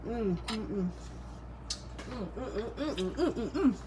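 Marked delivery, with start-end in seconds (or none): none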